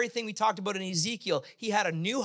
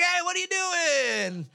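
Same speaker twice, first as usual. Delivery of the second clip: high, drawn-out indignant holler; very vocal; 11 semitones higher, 3 dB louder, slower than usual. Retold or imitated. Wife to a careless driver, imitated stretched high yell. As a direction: mimic a wife yelling from the car. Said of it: "she was just very vocal".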